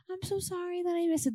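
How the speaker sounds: high, soft put-on girl voice. Girl apologizing to pastor for missing youth group, high girlish voice. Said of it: "the girls are the ones who are like".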